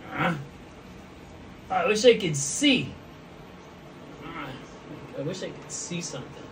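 usual speaking voice

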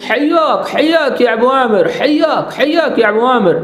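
high, lilting Arabic accent on حیاک; Arabic-accented welcome. Shown as a demonstration. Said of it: "اس طرح سے accent نکالنا ہے".

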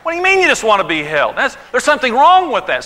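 sharp rising what, mock baffled husband; indignant surprise. Husband baffled by wife wanting only holding. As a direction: play the confused, put-out husband. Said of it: "He goes".

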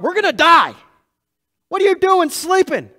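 high, loud frightened cry of the disciples; panicked disciples. Retold or imitated. Disciples in the storm crying out to sleeping Jesus, panicked and high.

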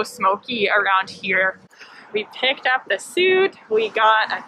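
muffled behind cloth mask; speaking masked outdoors; through a face mask. Real, not acted. Seen 1: Cloth mask covers mouth and nose throughout; car refs unmasked.

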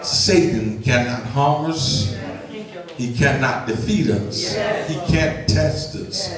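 steady, measured public prayer; earnest prayer. Acted. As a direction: pray aloud before the congregation, deliberate and sincere.

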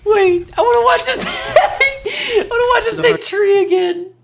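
squealing high through laughter; laughing. Real, not acted. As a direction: talk while cracking up. Laughing hard at the video.

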